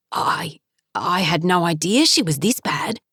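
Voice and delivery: breathy hush of shock at the bedside; half-voiced, barely getting the words out; father shaken at the sight of his daughter; stunned hush. Father sees injured daughter.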